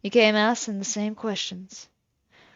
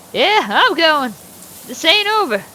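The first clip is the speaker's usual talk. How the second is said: put-on a loud, higher-pitched cantankerous old farmer; gruff old farmer; 5 semitones higher, 7 dB louder than usual. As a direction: play the surly old landowner, talking down to the neighbor. Plays Mister Morgan.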